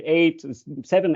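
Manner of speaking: ordinary talk, nothing marked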